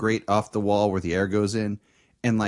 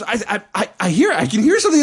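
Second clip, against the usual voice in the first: high stammer that gets loud; frantic; 10 semitones higher, 5 dB louder, faster than usual. Retold or imitated. Girlfriend hears something in the vent, frantic. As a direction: imitate someone tripping over words in panic.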